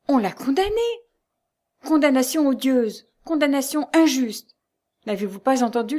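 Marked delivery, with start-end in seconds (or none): 0.1-4.6 high, emphatic outcry; impassioned protest. A narrator's reading. The condemnation argued over by Lucie and Jeanne, impassioned. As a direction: protest with mounting indignation.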